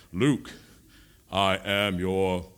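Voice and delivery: low, slow, heavy Vader voice. Shown as a demonstration. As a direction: drag it out deep and menacing. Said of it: "I'll say it again deeper".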